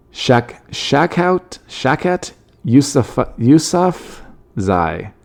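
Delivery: Shakhout Yusufzai spelled out very slowly, syllable by syllable; sounding out a name. Shown as a demonstration. Vlogger slowly sounding out the politician's name. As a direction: drag out Yusufzai carefully. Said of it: "struggling with a name".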